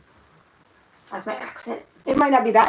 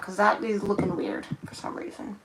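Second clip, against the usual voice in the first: whisper; 6 dB quieter than usual. Real, not acted. Aside on the second coat.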